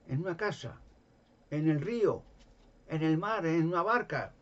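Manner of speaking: más agudo y fuerte en cada lugar; énfasis creciente. Real, not acted. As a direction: martillea cada sitio. Enumeración enfática. (enumeración enfática y fuerte)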